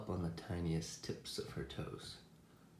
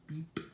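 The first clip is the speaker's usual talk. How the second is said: high, short horn beep; quick high toot; truck beep; 8 semitones higher than usual. A narrator's reading. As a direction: imitate a little truck's horn. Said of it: "Blue said".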